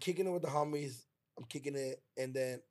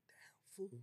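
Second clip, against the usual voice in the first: whispered, soft and sorry; sad whisper; 5 semitones higher, 15 dB quieter than usual. Retold or imitated. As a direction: breathe it out like it hurts to see. Sad reaction to friend.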